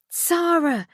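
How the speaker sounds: high urgent call after her; Lucy calling after her fleeing friend, a high urgent cry. A narrator's reading. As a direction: cry out her name as she runs off. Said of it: "Lucy cried behind her".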